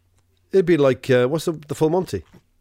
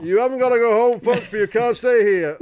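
tired last call shout; 8 semitones higher, 4 dB louder than usual. Pretend. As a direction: call out closing time to the punters. Landlord ushering punters out.

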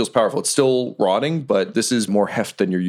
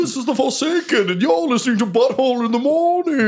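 radio DJ voice. Pretend. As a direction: announce it like a morning show. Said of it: "Sound like a radio D J".

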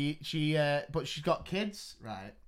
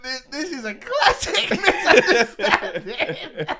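slow, laughing through it; 9 semitones higher, 9 dB louder, slower than usual. Retold or imitated. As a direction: laugh as you say it. Retold reply, laughing.

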